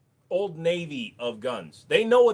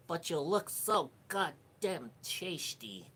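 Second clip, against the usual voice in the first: creepy predator; 2 semitones higher, 6 dB quieter, slower than usual. Pretend. Acting a predator's reply.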